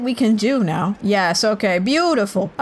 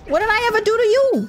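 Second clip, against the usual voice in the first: indignant; 10 semitones higher, faster than usual. Real, not acted. Player protesting at an attacking enemy, high indignant cry. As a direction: demand an answer, truly offended.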